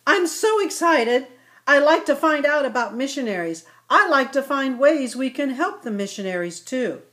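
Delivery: put-on a little kid who can hardly wait; excited little ibex. Ilex speaking eagerly.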